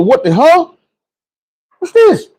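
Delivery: high startled question; shocked. Retold or imitated. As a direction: jump up in alarm at the sight. Shocked at huge shoes.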